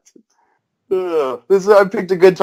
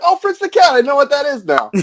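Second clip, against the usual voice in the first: high-pitched mock-excited realisation; excited recognition; 11 semitones higher than usual. Telling co-hosts about rewatching the episode, excited recognition. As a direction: play up suddenly getting the reference, voice way up.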